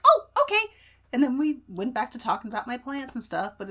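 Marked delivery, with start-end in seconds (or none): 0.0-0.7 high, louder little 'oh, okay'; young child's reply. Retold or imitated. Young child answering auntie about the toys, imitated piping reply.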